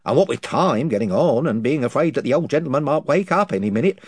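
rambling invisible chief. Acted. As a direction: ramble through the tale, losing the thread. Chief tells his tale.

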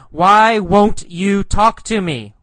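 high, loud demand for an answer; yelled question. Acted. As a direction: call it out insistently. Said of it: "He yells".

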